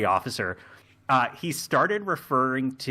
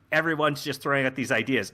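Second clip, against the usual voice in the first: ordinary talk, nothing marked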